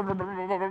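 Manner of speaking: sputtering brr of a small engine; playful engine rattle, lips buzzing; imitated car engine. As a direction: mimic a maxed-out little car motor. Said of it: "it'd kinda go brr".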